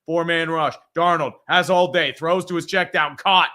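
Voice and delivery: sportscaster calling the play. Acted. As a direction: call it like a TV announcer. Live game play-by-play.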